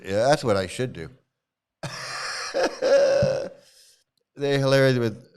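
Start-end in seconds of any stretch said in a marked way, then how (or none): 1.8-3.5 short breathy burst of laughter. Real, not acted. Host laughing at a commenter's comeback, amused laugh. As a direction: laugh at the troll.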